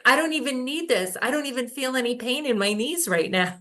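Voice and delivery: high, fast, amazed quote; amazed client. Retold or imitated. Client telling her healer she feels no pain, high and amazed. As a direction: rush it out, astonished and high. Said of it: "And she was like".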